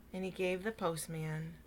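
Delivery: usual speaking voice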